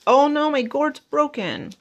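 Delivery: high, alarmed cry of dismay; suddenly high "oh no" at the broken gourd; real alarm. Real, not acted. Haul vlogger thinking her gourd broke, high alarmed. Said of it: "got all excited thinking my gourd was broken".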